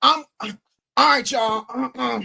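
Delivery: halting, stammering radio read; mock a host who can't read aloud; lightly voiced fumbling announcer; stumbling bad reader. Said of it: "it sounds like".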